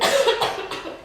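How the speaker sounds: short fit of coughs; coughing. Acted. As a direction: cough from a heavy cold. Complaining of a bad cold.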